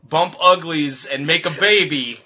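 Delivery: usual speaking voice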